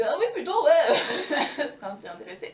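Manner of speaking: high, loud, giggling eagerness; eager girl. Acted. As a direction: jump at the offer, laughing. Blondine eager to redeem herself.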